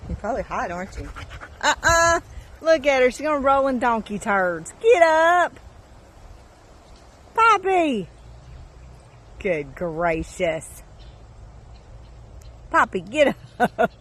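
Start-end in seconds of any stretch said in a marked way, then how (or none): 1.6-5.6 high, loud telling-off; loud scold at a dog rolling in donkey dung. Real, not acted. 7.3-13.9 loud scold at Poppy to get up; exasperated scold. Real, not acted. Scolding dog rolling in dung.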